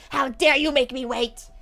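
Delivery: lightly voiced angry customer scolding the clerk; mock angry customer. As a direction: imitate an offended customer, huffy. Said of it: "I got yelled at".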